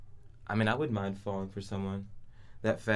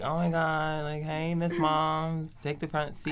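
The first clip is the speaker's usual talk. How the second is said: sweet higher, polite hostess; polite lady voice; 6 semitones higher, slower than usual. Retold or imitated. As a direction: play the gracious girlfriend, lightly. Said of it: "be the lady and be like".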